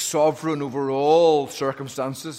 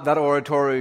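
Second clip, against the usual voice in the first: normal talking voice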